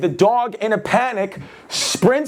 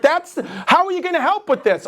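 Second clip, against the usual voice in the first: higher, doubting lady voice; mock skeptical lady; 8 semitones higher, faster than usual. Homeowner doubting the physical therapist, higher mock voice. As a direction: needle him as the doubtful homeowner.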